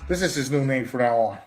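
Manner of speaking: ordinary talk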